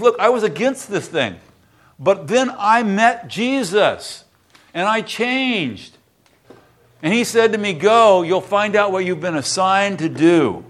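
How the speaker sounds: high, slow preacher voice, drawn out on the key words; impassioned preaching. Acted. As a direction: lean into it slowly like a revival preacher. Preaches testimony again, slowing.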